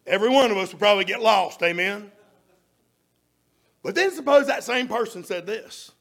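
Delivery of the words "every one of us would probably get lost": ordinary delivery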